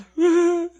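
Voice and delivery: high, loud mock sob; imitated husband crying. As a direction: burst into a wail like an overwhelmed husband. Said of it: "ele começou a chorar".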